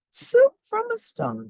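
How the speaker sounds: put-on very high, slow little old lady marvelling. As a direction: squeak it like a tiny amazed old woman. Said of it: "said the little old lady".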